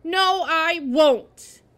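loud, very slow, stubborn refusal; high, emphatic, drawn-out defiance; defiant refusal. A narrator's reading. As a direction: refuse flatly, leaning on every word. Boy defiantly refuses the doctor.

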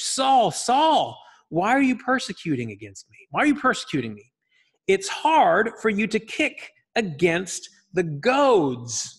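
high, loud, ringing call of the name; booming voice from heaven. Acted. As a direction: call down from the sky with authority. Reading Jesus's words in Acts.

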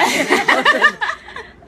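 a high, loud burst of real laughter. Real, not acted. Guest caught off guard on live TV, bursting into loud laughter. Host's slip makes her laugh.

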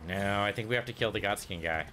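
ordinary delivery

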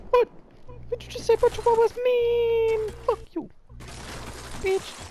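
very high, squeaky little dog voice; tiny high-pitched mock outrage; offended Chihuahua. Plays an offended Chihuahua answering back.

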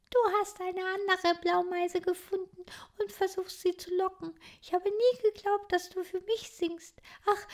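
a shy little titmouse speaking; young bird girl. Acted. Clotilde voiced by reader.

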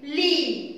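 لي as a brief "li"; quick short لي; short vowel. Shown as a demonstration. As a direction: say لي short, unstretched. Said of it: "تحتيه الكسرة صوته ايه".

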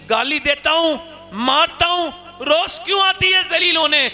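rising exasperation. Retold or imitated. Chaudhary scolding his daughter, rising exasperated voice. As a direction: build up the rebuke. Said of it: "बोला".

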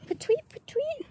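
light, rising bird whistle; peewee bird call. Retold or imitated. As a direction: imitate a little bird, sliding up high. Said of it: "The ones that go".

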